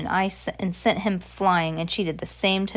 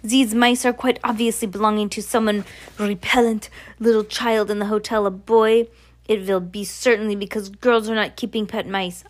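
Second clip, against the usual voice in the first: put-on accented witch villain, emphatic and disdainful; imperious witch; 3 semitones higher, 3 dB louder than usual. Voices the Grand High Witch.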